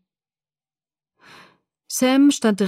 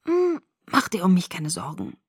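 lightly croaky, softer reply; croaked; 4 dB quieter than usual. A narrator's reading. Sick girl reassures Jacob, mild croak. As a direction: rasp it out a little, weakly. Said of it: "krächzte ich".

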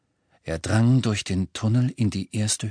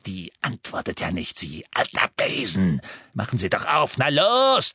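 impatient insult. A narrator's reading. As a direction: start as a coarse mutter, end shouting. Amicus insults and orders professor.

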